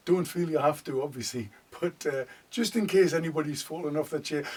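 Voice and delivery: ordinary delivery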